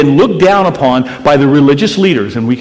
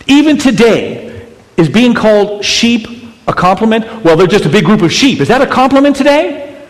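high, loud, driving preacher voice; full-force preaching; 8 semitones higher, 5 dB louder than usual. Acted. Pastor rousing the congregation about sheep, fiery preaching. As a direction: hammer the point home to the crowd.

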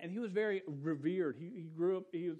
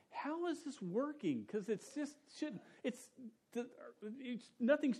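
baffled wonder; 8 semitones higher than usual. Retold or imitated. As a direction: ask it in amazed disbelief. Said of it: "I'm, like, trying to figure it out, going".

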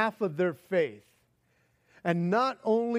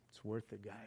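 quiet contented; 10 semitones lower, 18 dB quieter, faster than usual. Retold or imitated. Paralytic speaks quietly.

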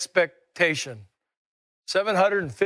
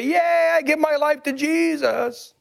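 excited cheer; 7 semitones higher than usual. Shown as a demonstration. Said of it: "and say".

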